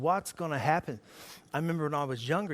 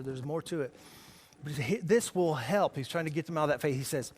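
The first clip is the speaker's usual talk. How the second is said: ordinary talk, nothing marked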